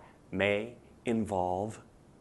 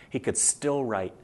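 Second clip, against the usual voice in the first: ordinary talk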